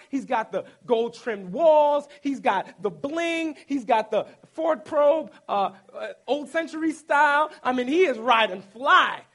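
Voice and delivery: loud mock swagger; hyped bragging. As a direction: hype up the bling like a proud boast. Bragging about Moses' palace life.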